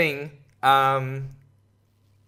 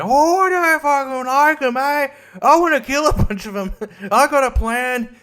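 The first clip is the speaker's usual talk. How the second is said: much higher, exaggerated cartoon bigot; mock bigot voice; 11 semitones higher, 4 dB louder than usual. Mocking someone who plans violence, high hammed-up bigot voice. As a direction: ham up a gleeful hateful ranter.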